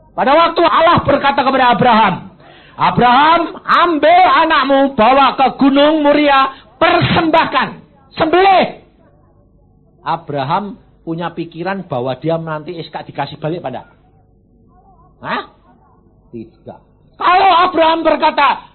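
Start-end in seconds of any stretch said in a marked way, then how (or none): 0.1-8.8 loud, high booming command; full-force preached divine command; preaching at full force. Acted. Preacher voices God to Abraham. 17.2-18.7 loud, high emphatic build-up; emphatic pulpit shout; loud preaching. Real, not acted. Preacher raises voice mid-sermon.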